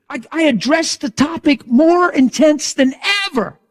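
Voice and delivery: high, loud and fired up; intense. Real, not acted. Preacher telling the crowd how hard he pressed, loud and high. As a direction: shout it with full fervour.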